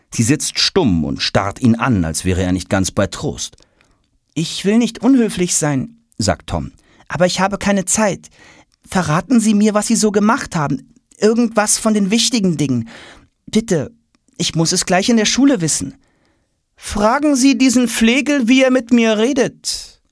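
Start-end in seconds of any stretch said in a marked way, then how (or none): 4.3-6.0 put-on high, bright-eyed boyish questioning; a curious schoolboy firing questions; eager boy Tom. Schoolboy Tom pestering the silent queen with questions. 7.1-15.9 put-on high, pleading boyish push; urgent boy Tom. Tom begging Kleopatra for answers before school. 16.8-20.0 very high, lofty, disdainful queen voice; haughty queen. Acted. Kleopatra scorning Tom to her servant, haughty.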